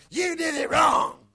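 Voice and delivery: drawn-out, high shouted accusation; mock accusing shout. Preacher imitates blaming a sinner, high slow shout. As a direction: shout it slowly, pointing the blame.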